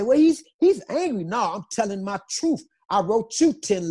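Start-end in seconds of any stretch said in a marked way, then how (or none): 0.0-1.2 pitched-up mimic of critics calling him angry; lightly mock the reporters' verdict, higher; mock accuser. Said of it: "and they say".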